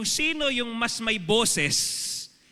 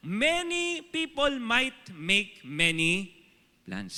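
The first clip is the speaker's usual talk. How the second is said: slow verse recital; slower than usual. Shown as a demonstration. Repeating the verse.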